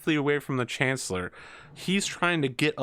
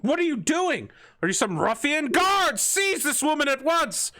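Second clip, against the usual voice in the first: outraged chancellor. Acted. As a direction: shriek for the guards. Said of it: "he's just like".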